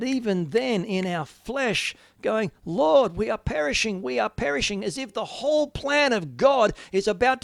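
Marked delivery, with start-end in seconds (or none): 2.6-4.8 panicked cry. Acted. Disciples panicking in the flesh.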